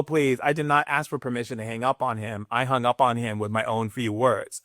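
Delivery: normal talking voice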